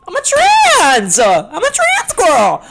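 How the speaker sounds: high, slow, rising shriek; mock panicked realization. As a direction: play the horrified future self, voice cracking upward. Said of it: "and I'm like".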